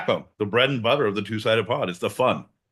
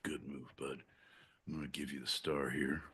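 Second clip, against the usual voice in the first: hushed whisper aside; 6 semitones lower, 17 dB quieter than usual. Real, not acted. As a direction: whisper it under your breath. Unvoiced aside while waiting.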